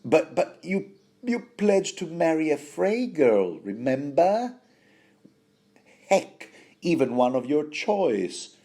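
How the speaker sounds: high-pitched, mock-scolding mother; a mother reproaching her boy with tame swearing; Catelyn. Podcaster voicing mother reminding son of his pledge, mock scolding. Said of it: "The sort of swearing that mothers would".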